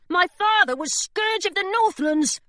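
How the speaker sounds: Charlie protesting. A narrator's reading. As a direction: plead rank. Charlie boasts.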